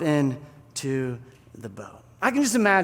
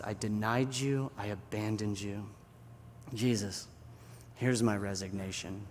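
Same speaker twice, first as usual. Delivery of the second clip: low, soft, heavy admission; remorseful confession; 4 semitones lower, 6 dB quieter than usual. Acted. Peter resigns to Jesus after denying him, remorseful. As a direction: confess in quiet defeat.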